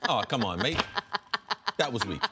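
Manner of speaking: light mock-Australian accent with a laugh. Mocking Australians watching the feeble brawler, light Australian accent. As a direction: put on a brief Australian accent, amused. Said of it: "good little abbreviated Australian accent".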